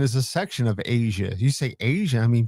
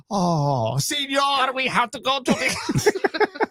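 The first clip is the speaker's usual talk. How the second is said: high, slow mock Mexican accent; 10 semitones higher, much slower than usual. Showing the guys a Mexican-accent joke, hammed up. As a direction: ham up a Mexican accent, drawn out. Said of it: "if you do it for, like, Mexicans".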